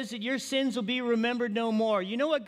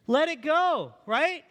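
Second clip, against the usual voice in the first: louder, higher: let it go; loud exhortation; 5 semitones higher, 5 dB louder, slower than usual. Real, not acted. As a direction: call it out to the congregation. Preacher exhorts loudly.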